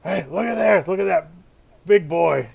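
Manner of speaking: loud, high shouting guard; gruff dwarf guard. Acted. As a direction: call out like a rowdy dwarf guard. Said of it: "And one of them goes".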